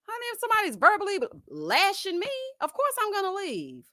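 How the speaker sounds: high-pitched, incredulous retort; high mock-outraged reply; indignant comeback. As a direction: answer him as if it's obvious. Acted indignant answer to him.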